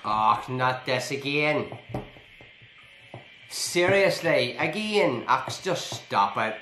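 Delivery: loud, slow, high-pitched exasperation; real fed-up outburst at the game; exasperated. Real, not acted. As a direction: protest it loudly, dragging out each word. Game kicks player out again.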